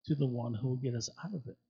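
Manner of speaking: ordinary delivery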